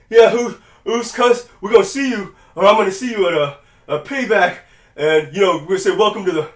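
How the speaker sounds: gasped fragments, hammed up; mock out-of-breath promo. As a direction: imitate a winded wrestler struggling through an interview. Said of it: "breathing out of control during the post interview".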